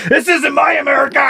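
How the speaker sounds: high, hammed-up patriotic outrage; outraged yell. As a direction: shout it in mock indignation. Acting outraged man's rant.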